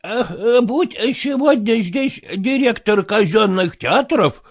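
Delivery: slow, mumbling toothless count; toothless mumble. Acted. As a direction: gum the words, old and grand. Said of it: "шамкает граф".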